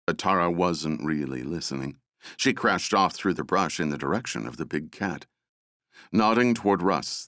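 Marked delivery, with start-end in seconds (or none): none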